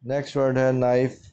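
ordinary delivery

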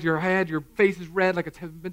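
normal talking voice

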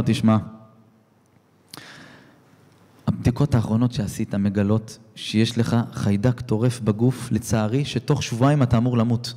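a lightly voiced somber doctor; grave doctor. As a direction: break the bad news heavily. Doctor delivers grave news.

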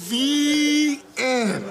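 high, loud, drawn-out the end; mock-triumphant finale; grand loud finish. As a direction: close the story with a flourish. Grand loud story ending.

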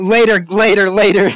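loud, hurried refusal; urgent shout. Real, not acted. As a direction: shout it while running away. Refusing photo, fleeing ghost.

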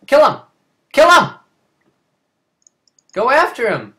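loud, high urging yell; shouting. Real, not acted. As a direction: cheer your teammate on. Urging teammate in fight.

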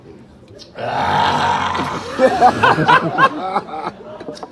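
loud breathy wheeze of a laugh, high up; a real laugh that chokes into a wheeze; wheezing laughing fit. Real, not acted. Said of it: "that was the coronavirus coming out".

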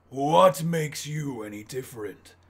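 slow, proud villain taunt; villain challenge. Retold or imitated. Villain daring the hero to prove himself, slow and proud. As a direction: toss the question down at him. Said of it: "he's giving his speech".

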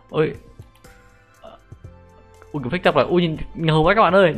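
loud, high burst of amazement; amazed excitement. Real, not acted. Gamer showing viewers a cool transformation, loudly amazed.

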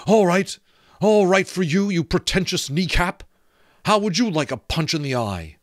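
loud, high furious shout. A narrator's reading. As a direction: shake your fists and yell at the skull. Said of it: "shook both fists at the skull and shouted".

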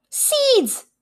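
high, bright exclaim; excited discovery. A narrator's reading. Narrator revealing Bear's discovery, excited. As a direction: announce the find with delight. Bear finds the seeds.

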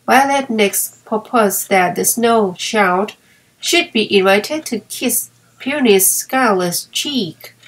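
ordinary delivery

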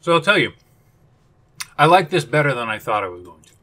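speaking between bites; talking while chewing. Real, not acted. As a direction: mouth partly full of chocolate. Seen 0: Bitten chocolate bar in hand; cheeks bulge, jaw chewing between words.

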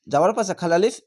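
usual speaking voice

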